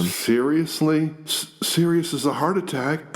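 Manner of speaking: sarcastic brother mocking an apology. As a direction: throw his words back at him with scorn. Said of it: "Eric mocks him".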